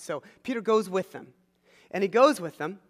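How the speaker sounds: ordinary delivery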